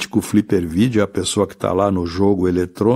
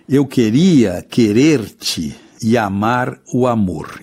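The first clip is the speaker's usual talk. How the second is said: poem recitation; slower than usual. Shown as a demonstration. Teacher recites verse slowly.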